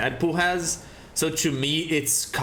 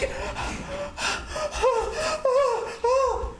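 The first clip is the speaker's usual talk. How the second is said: mock gasp. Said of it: "And you're like".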